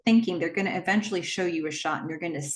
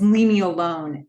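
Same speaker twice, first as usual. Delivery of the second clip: sharp, louder retold snap; a fed-up retold leave me alone; angry retort; 7 dB louder than usual. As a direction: throw the line back at the caller in anger. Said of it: "She's so angry now".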